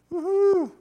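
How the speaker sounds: high, loud whoop; excited cheer. Pretend. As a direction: let out a big cheer. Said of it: "Sorry, I was waiting for that".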